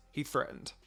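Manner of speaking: plain reading voice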